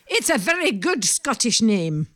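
put-on Scottish lilt, badly done; attempted Scottish accent. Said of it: "sorry about the accent".

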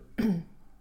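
kort kuchje in de keel; keel schrapen. Real, not acted. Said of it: "Even een slokje water tegen het kuchen". (kort kuchen)

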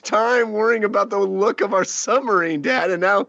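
whiny son voice. Retold or imitated. Son voiced complaining to dad.

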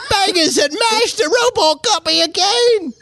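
a clueless Brit-Aussie mash-up, yelled; mock Australian accent. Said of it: "And I was doing an Australian accent".

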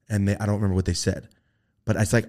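normal talking voice

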